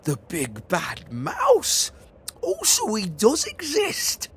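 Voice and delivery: put-on the kid realising the monster is real; frightened child. Gruffalo's child seeing the big bad mouse, scared.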